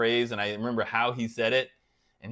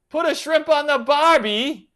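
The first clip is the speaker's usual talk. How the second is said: put-on loud, high, butchered Australian accent; Jim Carrey's Australian accent; 12 semitones higher, 9 dB louder than usual. Streamer teasing Eric from Australia, botched loud Australian accent. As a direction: belt out a hammy, bad Aussie accent. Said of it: "I totally just butchered the accent".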